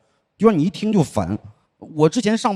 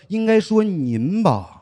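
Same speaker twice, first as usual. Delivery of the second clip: slow, drawn-out polite correction; mock deferential correction; 5 semitones higher, much slower than usual. Correcting the boss's form of address to him, slowly and with mock deference. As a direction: put on exaggerated respect.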